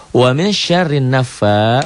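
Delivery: "naffa" with the last a held long; mad asli. Shown as a demonstration. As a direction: recite "Wa min syarrin naffa" high and slow, lengthening the end. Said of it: "dibaca panjang satu alif". ("naffa" dibaca panjang satu alif)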